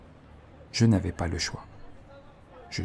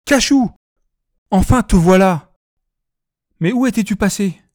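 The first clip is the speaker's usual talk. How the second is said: much higher and louder, frantic relief; panicked and relieved; 11 semitones higher, 8 dB louder than usual. A narrator's reading. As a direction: cry out, panicked, relieved to find him. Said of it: "à la fois affolé et rassuré".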